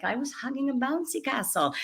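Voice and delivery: hint of a Scandinavian lilt on the bouncy castle line; Scandinavian accent. Host playfully quoting the book's line to the author in a mock Scandinavian accent. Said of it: "but in that Scandinavian accent".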